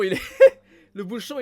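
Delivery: real loud giggle at the cap; laughing. Real, not acted. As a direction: laugh through the words le bouchon. Cracks up over the bottle cap.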